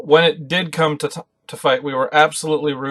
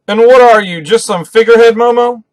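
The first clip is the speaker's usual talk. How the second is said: loud taunting yell, higher up; 8 semitones higher, 8 dB louder than usual. Retold or imitated. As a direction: taunt like a scolding captain. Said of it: "Luffy's yelling at Momo".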